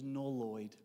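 a soft low voice breaking bad news; quiet, deadpan letdown; Mary letting him down. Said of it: "She goes".

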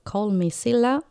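usual speaking voice

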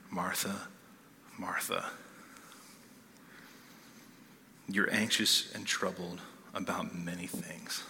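soft, whispered, gentle rebuke; Jesus gently whispering "Martha, Martha" to his stressed host; tender whisper. Acted. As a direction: whisper it like comforting an anxious friend. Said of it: "Such compassion, such tenderness".

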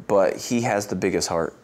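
ordinary talk

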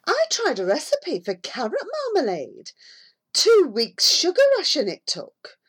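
lightly put-on complaining neighbour; voiced housewife complaining. A narrator's reading.

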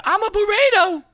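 loud, very high silly cry; goofy burrito character. Pretend. Teasing about being wrapped in a blanket, loud high silly voice. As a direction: squeal it like a cartoon burrito.

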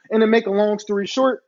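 ordinary delivery, nothing marked

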